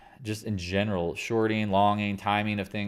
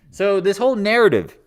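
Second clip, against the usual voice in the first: usual speaking voice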